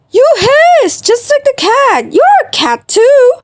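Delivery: high, loud teasing; taunting. Acted. Taunting accusation back and forth.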